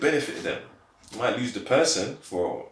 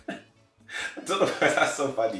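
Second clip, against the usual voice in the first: laughing; 4 semitones higher, slower than usual. Real, not acted. Laughing about shared jokes.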